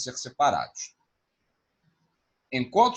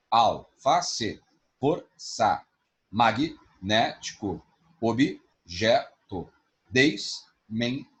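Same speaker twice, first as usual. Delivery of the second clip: slow syllable split; much slower than usual. Shown as a demonstration. Teacher slowly breaking words into syllables for the class. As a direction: slowly pull each word apart. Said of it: "syllable separation demo".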